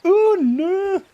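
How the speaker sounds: very high, loud Scottish gasp; startled Scottish maiden. Shown as a demonstration. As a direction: cry out like a worried Scottish maiden. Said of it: "some maiden who's startled or worried".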